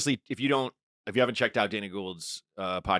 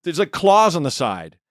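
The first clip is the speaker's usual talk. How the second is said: eager loud explaining; 6 semitones higher, 7 dB louder, slower than usual. Retold or imitated. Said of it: "And he's like".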